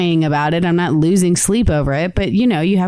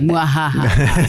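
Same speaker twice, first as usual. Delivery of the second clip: slow mock villain laugh; evil cackle; 3 semitones lower, much slower than usual. Threatens revenge, then evil laugh.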